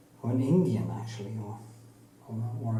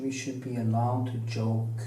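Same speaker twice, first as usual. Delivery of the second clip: ordinary talk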